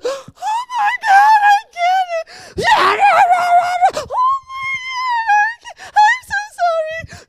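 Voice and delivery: high, loud, tearful wailing; imitating a mortified woman sobbing sorry; crying apology. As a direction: wail out sorry through tears. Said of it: "she'd be like".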